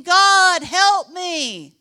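loud, high desperate cry to God; crying aloud. Pretend. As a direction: call out to God with everything. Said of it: "But no, he was saying".

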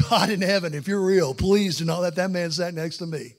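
loud, urgent pleading to God; fervent prayer. Retold or imitated. Passenger begging God to spare him a seatmate, loudly fervent. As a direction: pray with full-force desperation. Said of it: "the most fervent prayer mode".

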